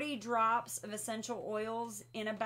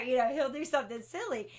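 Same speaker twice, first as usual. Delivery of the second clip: usual speaking voice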